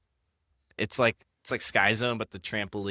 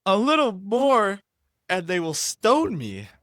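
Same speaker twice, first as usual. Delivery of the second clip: put-on much higher, louder, slow Jamaican-accented Moses; 11 semitones higher, 6 dB louder than usual. Reading Exodus, she runs with Moses's Jamaican accent. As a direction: keep the Jamaican accent going, stretched and high. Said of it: "we're gonna run with it".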